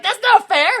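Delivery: high, loud protest; whiny shout. Retold or imitated. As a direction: wail it like a cheated kid. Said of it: "it's like".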